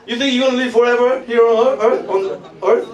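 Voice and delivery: usual speaking voice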